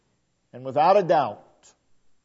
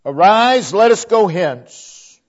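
ringing command; 4 semitones higher, 8 dB louder, slower than usual. Acted. Preacher voicing Jesus' command.

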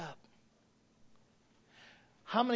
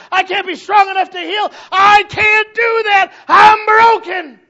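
desperate shouting; 20 dB louder than usual. Retold or imitated. Believer crying to the Lord, desperate high shout. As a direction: cry out to God with everything. Preacher voicing a broken believer.

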